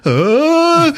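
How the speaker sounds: high, loud mock gasp; startled yelp. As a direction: cry out high in comic fright. Startled man sees pursuers.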